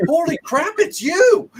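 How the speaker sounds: high laughing shout of holy crap; starstruck excitement. Retold or imitated. [name] meeting his YouTube fans at a show, laughing excited shout. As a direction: burst out laughing with delight. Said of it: "I'm like".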